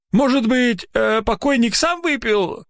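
put-on high old man's voice, unsure; old watchman. A narrator's reading. Old watchman timidly suggesting the corpse drank it. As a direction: offer the guess like a timid old man.

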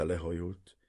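plain reading voice

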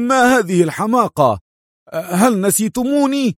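high, indignant cry in an assumed voice; Morris's disguised voice. Acted. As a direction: cry out without dropping the disguise. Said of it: "وصاح دون أن يتخلى عن صوت موريس".

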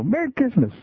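put-on high, sing-song child greeting; little girl voice. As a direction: chirp it like a cheerful four-year-old.

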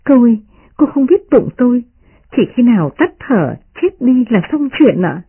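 lightly voiced, earnest servant; smiling maid. A narrator's reading. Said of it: "Tập Nhân cười nói".

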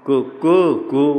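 कुकु कु crowed, each vowel longer; rooster crow. Shown as a demonstration. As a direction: show three vowel lengths like a rooster. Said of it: "तीन मात्रा थोड़ा लंबा कर देता है". (मुर्गे की तरह बढ़ती मात्रा में कुकु कु)

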